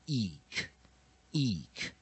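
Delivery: "eek" said as an isolated ending, cut off on k; weak-vowel ending demo. Shown as a demonstration. Teacher showing how an I ending is read, isolated "eek". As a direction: sound out "eek" alone, pausing between repeats. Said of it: "通常I结尾我们就念".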